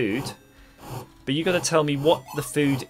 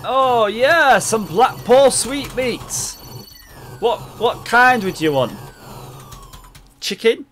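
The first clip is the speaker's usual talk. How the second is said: hammed-up vendor; 9 semitones higher, 7 dB louder than usual.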